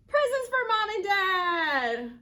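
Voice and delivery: loud, high, stretched-out reveal; slow, ringing proclamation; excited announcement. A narrator's reading. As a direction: announce the big challenge to little kids. Announces the next challenge.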